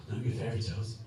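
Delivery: low, soft dying father; dying dad. Acted. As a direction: mumble it like a dying man. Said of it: "dad's voice answers".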